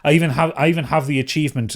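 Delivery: normal talking voice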